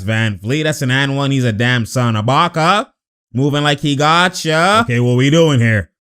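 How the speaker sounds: normal talking voice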